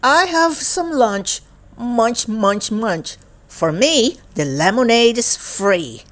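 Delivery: lively, sing-song picture book reading; reading to children. Acted. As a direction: read it brightly to a young child. Picture book read aloud.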